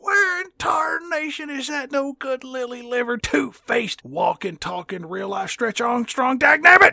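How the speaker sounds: lightly voiced old prospector. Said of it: "He's like".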